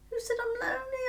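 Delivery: high, loud defensive retort; indignant retort. Retold or imitated. Mother answering her daughter's advice about being lonely, indignant. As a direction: throw the question back, pitched right up.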